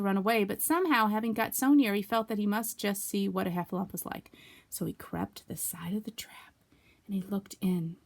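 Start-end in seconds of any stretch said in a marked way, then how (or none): 4.7-8.0 unvoiced creeping whisper. A narrator's reading. Narrator hushing as Piglet peeks into the trap.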